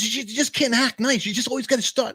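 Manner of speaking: high-pitched mock complaint; nagging wife. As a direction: imitate an exasperated wife telling you off. Wife complaining in acted quarrel.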